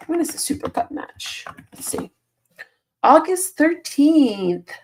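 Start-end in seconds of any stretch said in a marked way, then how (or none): 0.1-2.1 soft whispered question to herself; hushed muttered question. Real, not acted. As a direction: whisper it while looking something up. Wondering to herself.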